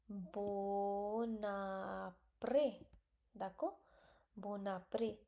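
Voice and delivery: usual speaking voice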